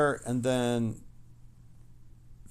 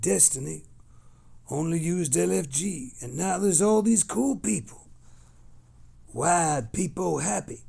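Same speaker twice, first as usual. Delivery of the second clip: higher, slower, put-on reading of a fan's message; hammed-up chat voice; 6 semitones higher, 6 dB louder than usual. Streamer voicing a Destiny fan's chat message, lightly hammed-up. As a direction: ham it up like an eager fellow fan.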